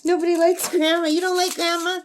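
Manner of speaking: high, lilting voice to a pet; teasing pet-talk. Real, not acted. Teasing chat with her bird.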